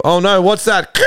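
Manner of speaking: sudden high startled cry; mock alarm. Boyfriend on the phone sees the crash coming, high mock alarm. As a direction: pretend to spot a crash ahead.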